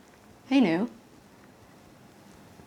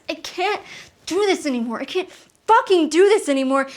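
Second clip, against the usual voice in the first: higher, mounting exasperation; desperate breaking point; 5 semitones higher, 4 dB louder than usual. Acted. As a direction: build to the end of your rope. Babysitter at breaking point behind door.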